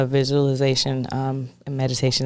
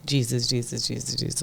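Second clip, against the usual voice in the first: repeated prayer; 5 dB quieter than usual. Shown as a demonstration. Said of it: "say that over and over again".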